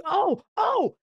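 high excited oh, oh; the light bulb goes on; sudden idea. Retold or imitated. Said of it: "I was like".

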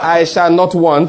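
loud, slow declaration; like you mean it. Shown as a demonstration. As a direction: proclaim each word slowly and forcefully. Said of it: "do it like you mean it".

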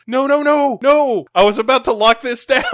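very high, loud mock panic; panicked Sandra Bullock. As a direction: shriek it like a frantic heroine. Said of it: "she's like".

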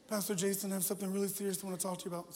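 put-on fast, earnest nerdy teen voice; Kip voice. Youth leader blurting to his pastor, fast Kip voice. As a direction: rattle it off like a nervous geek. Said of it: "he sounded just like Kip".

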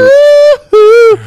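high, loud woo-hoo; cheer. Real, not acted. Friend cheers during the story, high and loud. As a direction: whoop it out.

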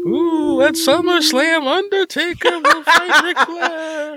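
high, slow, wailing wrestling manager; Paul Bearer impression. Host does ghost as Paul Bearer, high and slow. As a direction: drawl it like a creepy manager. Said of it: "my ghost always sounds like Paul Bearer".

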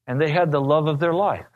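usual speaking voice

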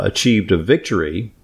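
ordinary talk

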